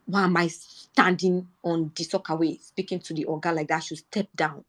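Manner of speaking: usual speaking voice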